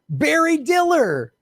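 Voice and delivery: the name Barry Diller sung out high and drawn out, slow; mock amazement. Shown as a demonstration. Host revealing the CEO to viewers, in high, slow mock amazement. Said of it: "repeated name".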